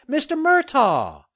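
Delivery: high, drawn-out cheery hail; cheerful cry. A narrator's reading. Reporter hailing the developer as she nears, cheerful cry. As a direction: sing out his name, high and slow. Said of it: "she cried cheerfully".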